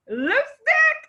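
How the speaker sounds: lipstick squealed high and drawn out; excited squeal. Real, not acted. Host thrilled by Mimi's lipstick gift, excited squeal. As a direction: burst into a delighted squeal.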